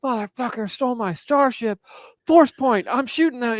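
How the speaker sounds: yelled high and loud, like a furious player; high, loud imitation of an outraged gamer; mock angry shout. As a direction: imitate a player blowing up over a stolen ship. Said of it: "just starts shouting".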